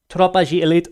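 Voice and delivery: Tropa de Elite announced loud and high, Portuguese sounding; Portuguese title. Shown as a demonstration. Announcing the Brazilian film title to viewers, loud and high. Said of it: "so I guess they say".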